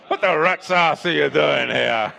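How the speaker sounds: loud words broken by real laughter; laughing. Real, not acted. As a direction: laugh your way through the line. Laughing at his own joke.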